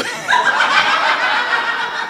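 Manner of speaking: high breathy laugh with no words; big laugh. Real, not acted. Storyteller laughing at his mom's line, real big laugh. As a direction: burst out laughing at the memory.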